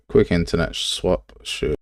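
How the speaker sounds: ordinary delivery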